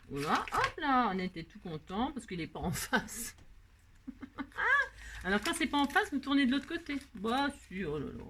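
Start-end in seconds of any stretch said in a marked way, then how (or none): 4.1-5.2 real high-pitched burst of laughter. Real, not acted. Crafter amused at the piece facing the wrong way, high laugh.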